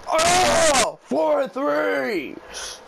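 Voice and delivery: loud high shout; excited shout. Real, not acted. Gamer celebrating a fourth three-pointer, excited shout. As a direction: yell in delight.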